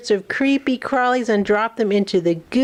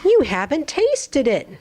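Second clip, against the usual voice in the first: higher character voice; 6 semitones higher than usual. Acted. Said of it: "And he says".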